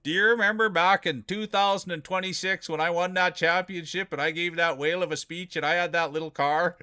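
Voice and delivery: put-on teasing the champion as his future old self; old man voice. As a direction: mimic a proud old man retelling his glory days. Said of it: "your eighty-five-year-old man".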